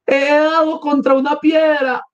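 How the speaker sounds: high, drawn-out cry of dismay; acted high-pitched outcry; alarmed shout. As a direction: cry out high over an injury. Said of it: "Gritó Sonia".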